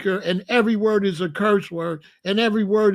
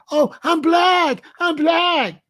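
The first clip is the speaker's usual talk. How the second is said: mocking high voice; 9 semitones higher, slower than usual. Host mocking the motivational speaker's claim, high and drawn out. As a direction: parody a boastful shop owner, pitched up. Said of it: "I'm saying".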